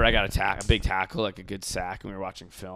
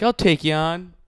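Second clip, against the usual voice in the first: mock bravado; 6 semitones higher, 7 dB louder, slower than usual. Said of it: "Just being like".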